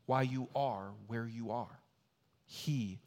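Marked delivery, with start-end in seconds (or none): 2.5-3.0 quiet reassurance. Acted. Quiet climax of the point.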